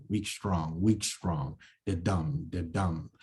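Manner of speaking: weak, strong said as da-DUM; chanting the iambic beat; weak then strong beats. Shown as a demonstration. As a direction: tap out the iamb, soft then strong. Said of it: "a weak rhythm and then a strong".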